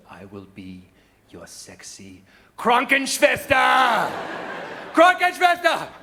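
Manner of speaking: mock-German roar on the last word; hammed-up German shout. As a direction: coo it, then bellow "Krankenschwester" like a drill sergeant. Seductive line bursts into German shout.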